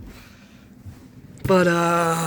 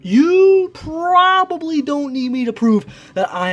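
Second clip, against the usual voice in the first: dramatic intro; 13 semitones higher, 7 dB louder, slower than usual. Acted.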